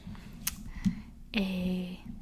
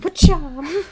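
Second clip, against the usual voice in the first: punch sound effect; 6 semitones higher, 7 dB louder than usual. Pretend. Imitating a hit with string.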